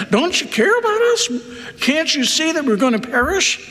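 mock-panicked plea, pitched high; freaking-out disciples. Disciples to Jesus asleep as they fear perishing, freaking out. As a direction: act out disciples in alarm, voice riding high. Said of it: "the disciples are freaking out".

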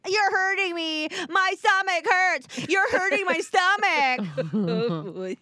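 high, drawn-out mock whine; dramatic child. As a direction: imitate a child wailing in exaggerated hurt. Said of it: "He's so dramatic".

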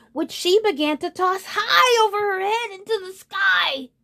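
very high, soaring storyteller voice; excited narration. A narrator's reading. Narrator as unicorn tosses objects high, excited and soaring. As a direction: send the voice way up with the toss.

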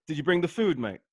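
Did you bring the food, mate said in a London accent; a quick London slang line in a British accent. Shown as a demonstration. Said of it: "can you say it in the accent?".

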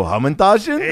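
high, questioning, laughing delivery; kid-show host. Acted. Host asking kids at show's end, chirpy.

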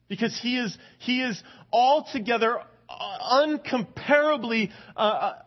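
high, loud exalting preaching; fervent sermon crescendo; preaching at full force. Acted. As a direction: lift it up in praise. Preaching God's perfection.

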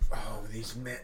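hushed whisper at the neighbours. Real, not acted. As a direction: whisper it under his breath. Annoyed at noisy upstairs neighbours.

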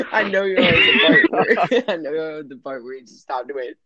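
words broken by laughter; giggling through the sentence; laughing. Real, not acted. Cracking up mid-sentence.

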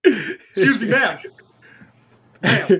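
stiff, officious security guard; playing a puffed-up mall cop; self-important mall cop. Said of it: "out the security guy voice".

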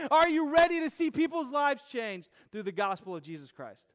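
loud, driving sermon climax; full-force preaching. Acted. Preacher rallying the congregation, loud and fervent. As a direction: build and preach it out loud to the room.

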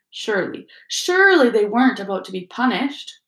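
ordinary delivery, nothing marked

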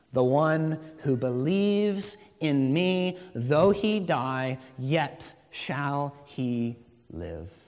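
slow, weighty quotation of scripture; solemn scripture reading. Acted. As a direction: let each phrase land slowly. Reading Jesus' words.

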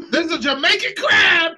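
high, loud, half laughing yell; laughing outraged shout. Real, not acted. Ranting about the crab, laughing outraged shout.

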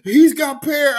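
put-on eager little kid; a kid bragging to dad. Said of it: "He was like".

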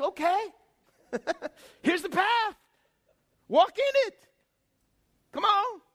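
high, bright, laughing God with a firm command; God chuckles, then points the way; God's voice. Retold or imitated. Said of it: "He says".

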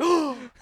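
mock gasp of shock; sharp imitated gasp. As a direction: gasp as if hit by surprise. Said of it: "shocked that it hit him".

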